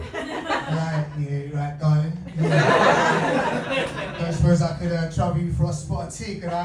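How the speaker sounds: low-pitched, hammed Jason Statham English; hammed-up English accent. Ordering tea at a cafe in a hammed-up English accent. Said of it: "I love to ham my accent up".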